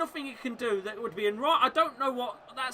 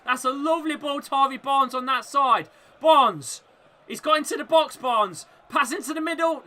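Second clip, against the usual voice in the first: live commentary; 5 semitones higher, 8 dB louder than usual. Real, not acted.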